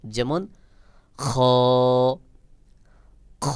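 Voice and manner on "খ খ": খ with a rounded sound, lips not rounded. Shown as a demonstration. Teacher shows the letter after explaining, round-sounding খ. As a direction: keep lips unrounded, make the long খ sound round. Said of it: "গোল মতো করে উচ্চারণ হবে".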